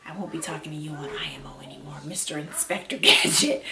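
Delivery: hushed teasing aside, laughing at the end; joking whisper. Retold or imitated. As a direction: whisper a playful brush-off to brother. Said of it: "And I'm like".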